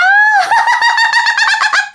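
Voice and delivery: very high, long eerie laugh; shrill ghostly cackle. Acted. Ghost woman laughing at dad, shrill cackle.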